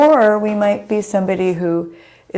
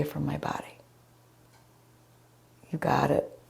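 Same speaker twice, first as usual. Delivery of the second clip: softer whispered cue; whispering; 3 semitones lower, 8 dB quieter than usual. Acted. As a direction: breathe the cue out softly.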